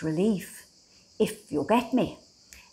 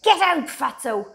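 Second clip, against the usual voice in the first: a mock shout, louder and a bit higher; imagined rude shout; 4 semitones higher, 6 dB louder than usual. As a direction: jeer it like a snooty shopkeeper, half joking. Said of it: "expect French women to ... shout".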